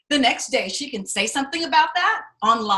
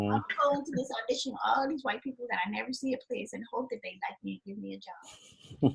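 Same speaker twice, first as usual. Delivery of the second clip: softer, changed voice of a naive actor; mocking the hopeful auditioner; mock resigned actor; 10 dB quieter than usual. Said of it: "drinking the Kool-Aid of".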